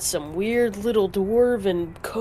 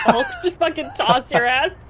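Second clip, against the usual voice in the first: laughing while talking; 5 semitones higher than usual. Real, not acted. Laughing through suggestion.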